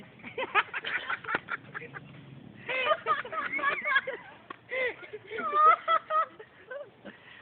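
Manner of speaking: long, high-pitched helpless laughter; laughing fit. Real, not acted. Roaster cracking up at own insults, laughing fit.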